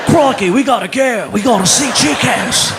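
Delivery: loud mock Australian accent, excited; hammed-up Aussie tourist eager for Chico's. Imitating Australian fans craving Chico's, in a mock Australian accent. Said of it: "people in Australia going".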